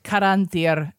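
Caranthir with a trilled r; rolled r. Shown as a demonstration. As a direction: roll the r in Caranthir. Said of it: "Nice roll there on Caranthir".

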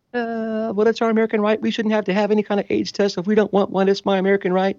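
a hint of tearful protest; upset dancer. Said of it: "the dancers started crying".

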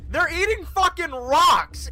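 high, loud outburst of disbelief; loud, high-pitched disbelief; incredulous yell. Real, not acted. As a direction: shout it like it's obvious. Players arguing over orc savagery.

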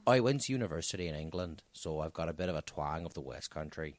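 put-on low, soft farmer-ish accent; West Country twang. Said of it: "You sound a bit farmer now".

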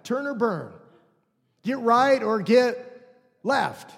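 slow, loud hellfire slogan; mock the street preacher; lightly hammed-up fire-and-brimstone preacher; megaphone preacher. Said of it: "the megaphone man".

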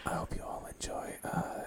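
soft playful ASMR whisper; giggly prank whisper. Pretend. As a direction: whisper close to the mic, giggling. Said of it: "let's whisper into the mic".

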